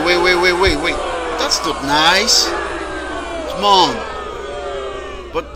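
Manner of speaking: usual speaking voice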